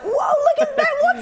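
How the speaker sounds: high, loud, laughing amazement; excited gawping. Retold or imitated. Sailor staring off the ship, loudly amazed. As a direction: gasp at the strange beasts. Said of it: "I'm like".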